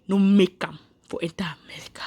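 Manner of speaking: hushed whisper, no voice at all. Real, not acted. Whispering to friends that the boy never reached America. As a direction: drop to a whisper for the sad part. Sad news about the boy.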